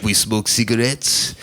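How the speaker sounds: put-on a lightly done, low, slow French accent; a weak stab at a French accent; bad French accent. Comic mimics the French in a half-hearted low, slow French accent. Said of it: "that's not a good French accent".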